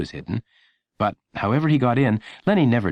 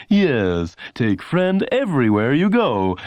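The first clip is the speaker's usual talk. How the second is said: higher, louder, slow ad tagline; announcer voice; 5 semitones higher, 4 dB louder, slower than usual. A narrator's reading. Announcer closing the commercial, slow tagline. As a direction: land the slogan like a pitchman. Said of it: "The announcer would say".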